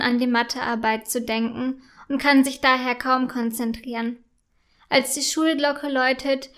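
ordinary delivery, nothing marked

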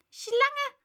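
high, loud bird screech; shrieking pigeon. A narrator's reading. Pigeon shrieking at Alice, high and loud. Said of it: "kreischte die Taube".

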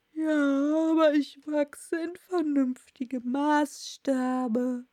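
slow, stretched creature voice; klagendes Murmeltier. Acted. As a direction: argue back as a plodding Dormouse. Dormouse argues back in character. (langgezogene Murmeltierstimme, widersprechend)

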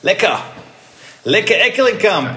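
loud, high playful cry; playful exclamation. Real, not acted. Relishing his new player's name, playful exclamation. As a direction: belt it out high and drawn out.

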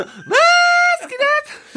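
very high, drawn-out shout of disbelief; stunned shout. Retold or imitated. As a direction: stretch it out in a shrieking mock yell. Narrator's disbelief at coincidence.

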